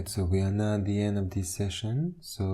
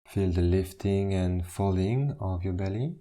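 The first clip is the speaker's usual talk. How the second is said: guided meditation. Acted. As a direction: ease them into stillness, one instruction at a time. Leads a standing meditation.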